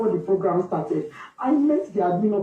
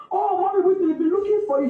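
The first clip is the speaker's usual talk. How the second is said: staff voice pitched higher, light and easy; calm, casual staff; 9 semitones higher than usual. Retold or imitated. School staff greeting the worried mother, calm and casual. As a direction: relay the news breezily, no alarm. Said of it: "He was so calm and casual".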